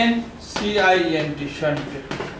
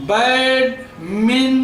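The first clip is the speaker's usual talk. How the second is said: Badminton very slowly in three separate syllables; much slower than usual. Shown as a demonstration. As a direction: break badminton into three drawn-out beats, pausing between them. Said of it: "ٽي syllable ٿي ويا". (Badminton کي ٽن سليبلن ۾ ٽوڙي، آهستي چئو)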